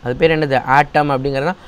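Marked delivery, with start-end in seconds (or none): 0.8-1.1 ஆட்டம் said "AA-tam", in two syllables; long first vowel in ஆட்டம், short ending; correct pronunciation. Shown as a demonstration. Teacher naming the word for pupils, correct pronunciation. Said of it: "அதோட pronunciation தான் ஆட்டம்".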